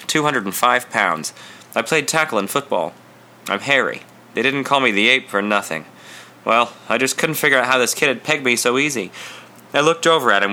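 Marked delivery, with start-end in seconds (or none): none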